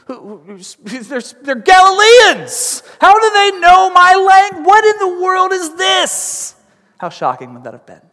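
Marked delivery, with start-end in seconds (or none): 0.0-0.6 astonished stammer, trailing into softness; puzzled and groping for words; amazed. Acted. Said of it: "They were amazed and astonished". 1.0-6.5 high, loud bewildered outburst; amazed and astonished. Acted. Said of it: "They were amazed and astonished".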